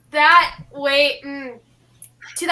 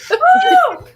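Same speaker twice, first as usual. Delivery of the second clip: excited whoop; 5 dB louder than usual. Real, not acted. Celebrating a right answer.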